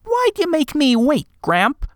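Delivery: put-on high young boy, impatient; a small boy asking why he waited; child voice. A narrator's reading. Boy voice in story.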